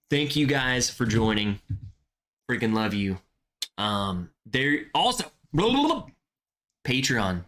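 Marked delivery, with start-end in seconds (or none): 5.5-6.1 high, breezy blah blah; dismissive blah. Real, not acted. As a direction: toss it off lightly. Dismissive filler.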